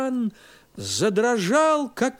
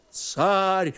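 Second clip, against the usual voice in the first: plain reading voice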